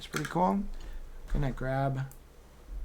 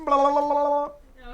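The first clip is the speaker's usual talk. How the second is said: high, loud country gibberish babble; mock Southern accent; 11 semitones higher, 6 dB louder than usual. Shown as a demonstration. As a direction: babble like an excited Southerner, pitched way up. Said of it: "my best impression of, like, a country Southern accent".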